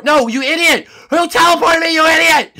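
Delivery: shrill angry shout; outraged yelling. Real, not acted. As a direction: yell at the culprit.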